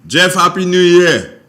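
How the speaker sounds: loud, high, slow call. Real, not acted. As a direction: call out to someone far off. Host calls to listener downstairs.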